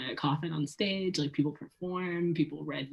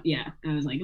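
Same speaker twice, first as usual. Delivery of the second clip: usual speaking voice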